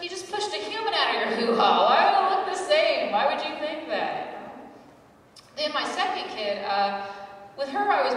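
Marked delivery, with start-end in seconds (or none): 0.0-4.4 higher, louder incredulous scolding, slowing at the end; exasperated mom. Retold or imitated. Mom on the phone to her daughter, incredulous and exasperated. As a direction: scold her like it's obvious. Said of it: "She's like".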